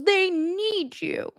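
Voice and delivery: very high, slow breathy mock; mocking Robin. Vlogger imitating Robin telling Cody, very high slow. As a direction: draw it out, syrupy. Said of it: "Robin goes".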